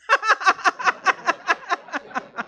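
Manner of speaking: long high staccato laugh, falling away; demonstrative laughter. Shown as a demonstration. Speaker showing laughing yoga to the room, infectious laughter. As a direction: laugh on purpose to get others laughing. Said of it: "just start laughing with me".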